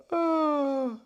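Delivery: high, breathy burst of laughter. Real, not acted. Host laughs at own Alaska joke, high laughter.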